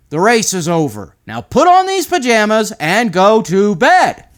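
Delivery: dad ending the race for bed; firm dad voice. Acted. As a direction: put your foot down at bedtime. Dad sending boy to bed.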